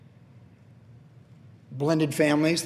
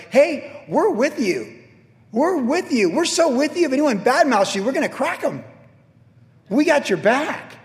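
much higher, eager cheering; eager followers; 9 semitones higher, 3 dB louder than usual. Retold or imitated. The people answering their new leader Joshua, eager high cheer. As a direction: gush loyalty like fired-up troops, climbing higher.

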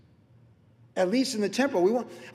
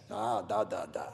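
put-on Russian accent; 8 semitones lower, 6 dB quieter than usual. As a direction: imitate a Russian speaker, deep and deliberate. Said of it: "with his Russian accent".

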